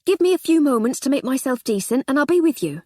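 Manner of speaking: put-on eager young policeman; young constable. Constable speaking.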